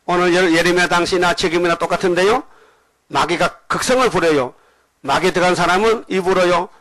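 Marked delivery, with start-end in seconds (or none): none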